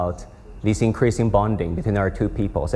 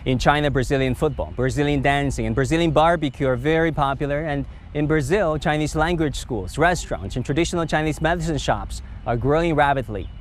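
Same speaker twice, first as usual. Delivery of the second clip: polished, slightly higher voice-over read; news read; 4 semitones higher than usual. Acted. TV host wraps up the Lula interview episode, polished anchor read. Host's closing voice-over and sign-off.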